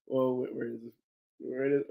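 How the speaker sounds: ordinary delivery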